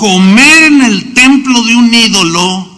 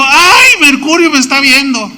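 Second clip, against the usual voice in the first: mock frightened whine; 7 semitones higher, 3 dB louder than usual. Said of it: "Y no dijo".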